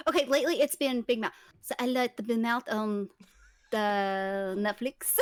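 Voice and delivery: halting, badly faked French accent; slow, hesitant stab at a French accent; low-pitched, unconvincing French accent; attempted French accent. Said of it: "answer this in your best French accent".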